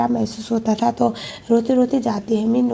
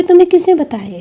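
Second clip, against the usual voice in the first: high, loud retold question; startled challenge; 7 semitones higher, 6 dB louder, faster than usual. Min asking Sheela who told him, startled challenge. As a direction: throw the question back, raised and loud.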